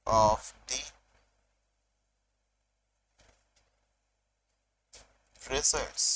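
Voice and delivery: very drawn-out, long silences between words; dictation. Shown as a demonstration. Teacher gives students the list to copy, slow dictation. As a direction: dictate piece by piece, leaving time to write.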